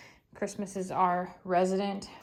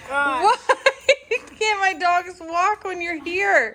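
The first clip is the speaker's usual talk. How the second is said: laughing disbelief; 9 semitones higher, 8 dB louder, slower than usual. Real, not acted. Laughing at dogs' ataxia.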